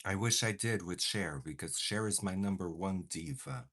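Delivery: normal talking voice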